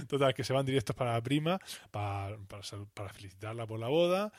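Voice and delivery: ordinary talk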